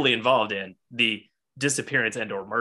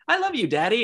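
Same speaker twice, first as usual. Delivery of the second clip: put-on little girl voice; 10 semitones higher than usual. Daughter voiced in retold scene.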